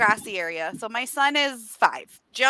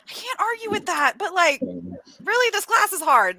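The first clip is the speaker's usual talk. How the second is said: higher-pitched, lightly hammed-up complaint; mock pleading; 6 semitones higher than usual. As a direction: plead with dad for a little sympathy. Retold student pleading with father.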